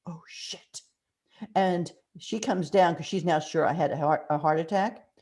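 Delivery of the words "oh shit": breathless whispered oh shit; whispered curse. Retold or imitated. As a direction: whisper it under your breath. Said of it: "I once again repeat".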